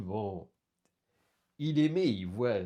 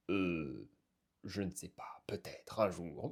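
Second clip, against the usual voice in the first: low, soft, uncertain reply; hesitant; 6 semitones lower, 6 dB quieter than usual. Acted. Borel weighs going back there, hesitant and quiet. Hesitant answer before an outburst.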